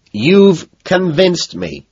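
high, drawn-out shout. A narrator's reading. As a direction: call it out slowly at him. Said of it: "I shout at him".

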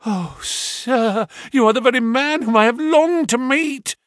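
high, loud, overjoyed outburst; a nervous man crying out in relief; little man greeting his rescuer eagerly. A narrator's reading. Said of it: "cried the little fellow".

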